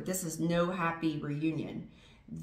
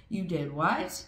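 ordinary delivery

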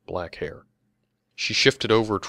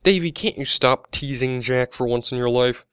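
higher, louder scolding complaint; complaining; 5 semitones higher, 7 dB louder than usual. A narrator's reading. Older woman telling Davy off for teasing Jack, complaining. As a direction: scold him in a raised, exasperated voice. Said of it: "complained a middle-aged blonde woman".

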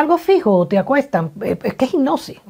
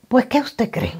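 whispered aside; 5 semitones higher than usual. Real, not acted. Teasing question to the audience.

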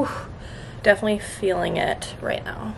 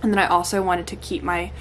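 normal talking voice

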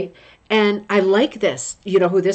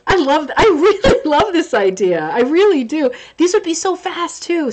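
excited; 8 semitones higher, 4 dB louder than usual. Real, not acted. Gushing over a viewer's idea.

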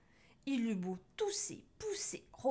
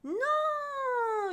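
long, very high loud scream; drawn-out high yell; howling witch; 6 dB louder than usual. A narrator's reading. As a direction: howl it out in horror. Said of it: "hurla Cornebidouille".

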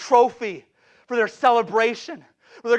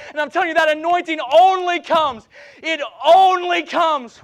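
full-force preaching; 5 semitones higher, 6 dB louder than usual. Real, not acted. Preacher tells church where revival comes from, full-force preaching. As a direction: build to a high, loud pulpit shout.

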